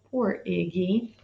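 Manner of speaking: soft sympathetic sigh of words; pitying aside. Real, not acted. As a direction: say it sorry for him.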